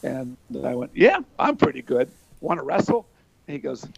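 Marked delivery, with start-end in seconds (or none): 0.9-3.1 put-on cocky teen. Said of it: "I was Mister Everybody".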